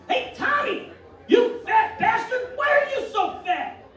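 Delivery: shouting Italian father at the dinner table; yelling father. Retold or imitated. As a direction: bark at the kid like an angry dad. Father yells at son at dinner.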